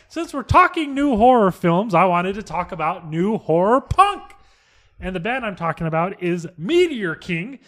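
loud, high, slow hyped read; radio host read. Acted. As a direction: pitch the band like a DJ. Reads prepared segment script.

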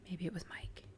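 conspiratorial whisper. Pretend. Conspiratorial suspect guess.

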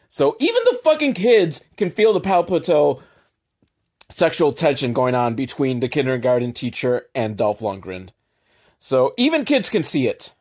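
ordinary delivery

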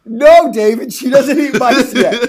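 high, loud exasperated correction; loud protest. Real, not acted. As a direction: cry out in amused objection. Exclaims objection to co-host's joke.

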